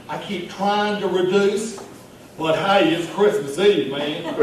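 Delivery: jovial bar regular ordering drinks; Vernon Ricketts. Acted. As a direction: play a festive pet-store manager ordering eggnog. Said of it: "read it in the voice of the character".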